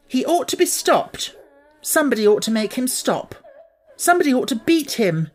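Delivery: high indignant cry, pace pushing forward; a girl working herself into a temper; angry outburst. A narrator's reading. Mary stamping her foot at Colin's tantrum, crying out angrily. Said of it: "she cried out".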